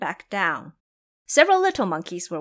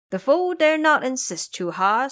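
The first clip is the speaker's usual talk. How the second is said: plain reading voice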